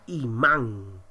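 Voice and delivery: 'imán' with force on the last syllable; stress on MÁN. Shown as a demonstration. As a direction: lean on and lengthen 'mán' in 'imán'. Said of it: "se encuentra en la sílaba man". ('imán' con fuerza en 'mán')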